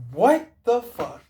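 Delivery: high, loud, slow disbelieving shout; shocked outburst. Retold or imitated. Onlooker watching Neo take off, high shocked yell. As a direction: blurt it out in shock. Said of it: "you'd be like".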